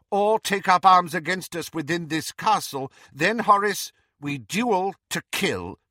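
firm warning building in pitch and force; stern McGonagall. A narrator's reading. Professor warns Slughorn against sabotaging resistance, sternly. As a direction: lay down an ultimatum.

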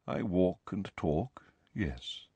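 slow, low, soft reply; quiet. A narrator's reading. Nick concedes he walks and talks, quietly. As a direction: admit it slowly, fading away. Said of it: "said nearly headless Nick quietly".

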